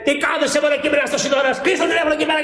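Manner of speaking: high, sharp scolding yell; retold scolding shout. The woman scolding the elder over the phone call, yelled. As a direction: snap at him to hang up the phone. Woman bursts in, orders hang up.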